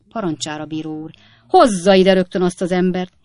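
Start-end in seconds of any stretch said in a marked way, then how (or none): 1.5-3.1 loud, urgent order; commanding judge. A narrator's reading. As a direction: order it done at once. Judge orders heckler brought in.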